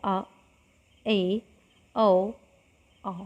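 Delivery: आ ए औ आ sounded one by one; vowel demonstration. Shown as a demonstration. Teacher repeats half-open vowels with students, clearly sounded. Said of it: "एक बार और बोल के देखते हैं".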